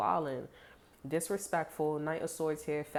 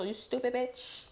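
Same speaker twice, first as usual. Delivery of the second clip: high, slow mock sneer; mock insult; 9 semitones higher than usual. Hothead insulting someone, high and slow. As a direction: drag the insult out with contempt. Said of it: "you know".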